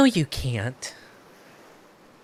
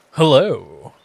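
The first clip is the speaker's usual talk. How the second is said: ordinary delivery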